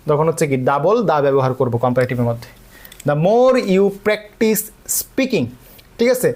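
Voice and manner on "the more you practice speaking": each word drawn out slowly for students; teacher spelling out an exam item at half speed; slow dictation. Shown as a demonstration. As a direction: read the test sentence very slowly, word by word. Dictating quiz sentence slowly.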